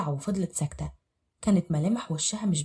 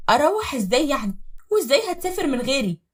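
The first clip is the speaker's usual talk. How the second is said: higher, louder upset wife; indignant; 8 semitones higher, 5 dB louder than usual. Acted. Wife protesting husband leaving her, indignant. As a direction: protest in disbelief.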